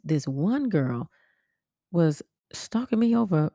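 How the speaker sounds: normal talking voice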